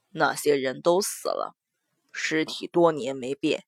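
low, soft grim man's voice. Acted. Mo Jie explains bodies.

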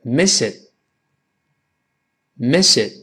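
"miss it" run together as "mi-sit"; linked reading. Shown as a demonstration. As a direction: link S into the vowel in "miss it". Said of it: "把miss it连读".

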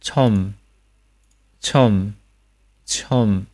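"처음" said as one syllable, chom; careful single-beat "처음", falling tone; final m demonstration. Shown as a demonstration. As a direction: round the o and shut lips on m in "처음". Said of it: "臭加收音".

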